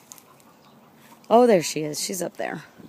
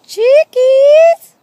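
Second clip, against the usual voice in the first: calling out; 24 dB louder than usual. Real, not acted. Calling chickens from afar.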